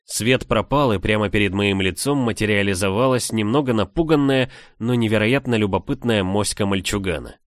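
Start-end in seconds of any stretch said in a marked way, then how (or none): none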